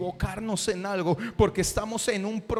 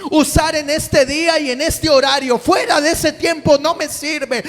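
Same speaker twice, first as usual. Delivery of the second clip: loud, high, driving sermon shouting; preaching at full force; 6 semitones higher, 11 dB louder than usual. Acted. Preacher calling the congregation to answer God, shouting. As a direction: preach it hard to the whole congregation.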